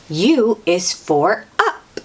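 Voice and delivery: plain reading voice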